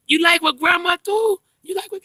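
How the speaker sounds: exaggerated sing-song baby talk. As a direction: coo to a baby in a high voice. Voicing talk to baby granddaughter.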